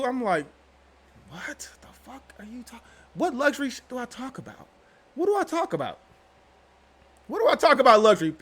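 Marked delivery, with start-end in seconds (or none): 1.3-2.8 breathless whisper of disbelief; stunned whisper reacting to a rude comment. 3.1-8.3 indignant. Retold or imitated. Indignant retort to a commenter.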